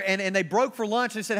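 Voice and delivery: ordinary talk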